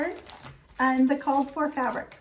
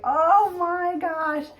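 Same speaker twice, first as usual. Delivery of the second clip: slow, drawn-out, louder oh my gosh; touched by her friend's surprise gift, slow and stunned; 6 semitones higher, 5 dB louder, much slower than usual. Retold or imitated. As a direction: stretch it out, overwhelmed.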